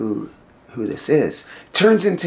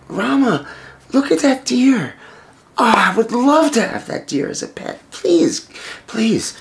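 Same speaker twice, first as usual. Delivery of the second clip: higher-pitched wishful begging; eager pleading; 8 semitones higher than usual. Acted. Wife begs husband for the deer, eagerly pleading in a raised voice. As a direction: beg sweetly for a treat.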